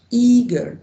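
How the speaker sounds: "Eager" loud and high, both syllables clear; syllable demonstration. Shown as a demonstration. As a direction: sound out "Eager" in two mouth movements. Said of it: "I changed the opening of my mouth".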